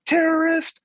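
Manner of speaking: high-pitched shouted taunt; kid's taunting yell. Retold or imitated. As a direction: shriek it like a bratty kid. Kids yelling at praying men.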